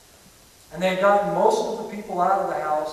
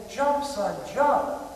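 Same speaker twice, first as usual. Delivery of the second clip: higher-pitched urgent call, not a full yell; retold father's urgent cry; 6 semitones higher, much slower than usual. Father urging his terrified son off the burning roof, crying out. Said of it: "his father cries out again".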